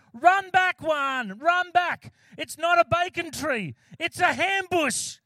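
high, loud shouted warning, punchline slowed; yelled warning. Acted. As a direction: scream at your friend to flee the ambush. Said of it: "he yells out to Juan".

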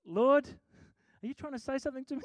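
high, quick startled question to the Lord; high and fast in amazed wonder; startled plea. Retold or imitated. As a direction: blurt it out amazed. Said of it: "I was like".

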